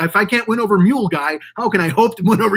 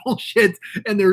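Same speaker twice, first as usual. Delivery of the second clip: normal talking voice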